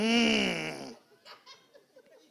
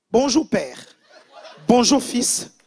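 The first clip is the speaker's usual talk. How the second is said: loud, chirpy roll-call greeting to God; imitated greeting prayer; 8 dB louder, slower than usual. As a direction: hail each one separately, imitating her prayer. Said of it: "Elle commence la prière".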